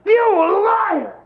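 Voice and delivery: frantic accusing yell, cracking up; paranoid ranting shout. Acted. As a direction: rant it like a paranoid madman. Mock paranoid breakdown rant.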